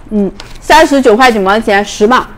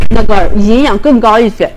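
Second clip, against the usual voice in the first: usual speaking voice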